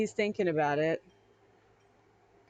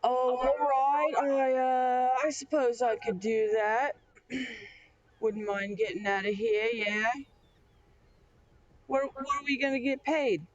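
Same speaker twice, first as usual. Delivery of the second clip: Georgie; 6 semitones higher, 3 dB louder than usual. Acted. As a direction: agree grudgingly, loud and stretched at first, then easing. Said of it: "and Georgie's like".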